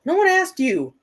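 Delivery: high exasperated retort; annoyed high retort; scolding Alexa. Real, not acted.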